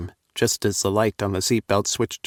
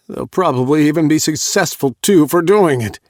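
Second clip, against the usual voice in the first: loud, high, frustrated outburst; exasperated loud complaint; frustrated cry; 7 semitones higher, 8 dB louder than usual. A narrator's reading. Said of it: "Owen cried in frustration".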